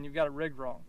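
ordinary talk, nothing marked

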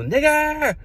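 put-on a kid yelling out the window; retold yell. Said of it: "He yelled out the window".

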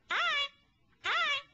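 a shy little puppet squeaks hi over and over; Koalaby puppet voice. Acted. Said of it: "Why don't you wave with your foot and just say hi?".

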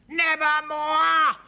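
slow, very high, loud croak of Nevermore; raven cry. Acted. Raven shouting as it pulls the man out, screeching. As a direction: screech Nevermore stretched out like a bird. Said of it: "at the top of his voice".

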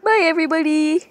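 quick, loud redo of the sign-off; fast redo of the goodbye. Shown as a demonstration. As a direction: blurt the goodbye again quickly. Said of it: "Actually, wait".

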